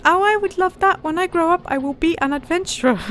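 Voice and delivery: an eager lad asking; boy voice. Acted. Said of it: "as it's a boy".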